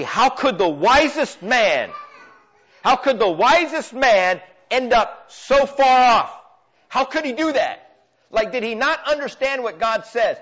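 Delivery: impassioned preacher, rising and pressing; preaching at full force. Acted. Pastor urging the congregation, fervent preaching. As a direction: drive the sermon home to the church.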